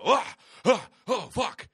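loud, high gasping cries; jolting awake. Retold or imitated. Man acting out waking from a nightmare, startled gasps. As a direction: gasp and curse as you jolt awake. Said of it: "I force myself to go".

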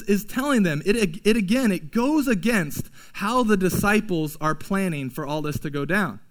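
ordinary delivery

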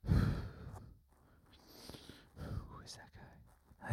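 very soft, slow whisper; hushed whisper. Acted. Meditators wondering about a heckler, slow hushed whisper. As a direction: whisper it to the person beside you. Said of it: "everyone's like".

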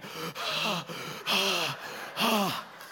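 imitated heavy panting; big audible out-breaths, spaced apart; heavy breathing. Dad imitating his son after the slide, heavy exhausted breaths. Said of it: "he's breathing heavy".

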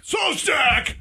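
military yell. Shown as a demonstration. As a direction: bark the name like a Navy chief. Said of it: "when you're yelling at somebody".

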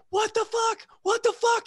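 imitating a buddy's high, squealing panic; retold freak-out. Soldier finds blood on his shirt, freaking out. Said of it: "He starts freaking out".

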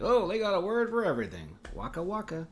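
high, put-on goofy learner voice; lightly hammed-up clueless tourist; mock naive learner. Mocking a language learner's delight, goofy high voice. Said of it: "and you're like".

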